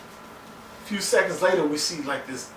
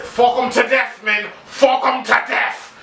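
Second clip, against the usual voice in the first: loud, high bellowed command to his men; shouted order; 8 semitones higher, 6 dB louder than usual. Retold or imitated. Wildling leader roaring orders at his men, loud shout. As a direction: yell it like a brutal leader rallying men. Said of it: "Like the guy said".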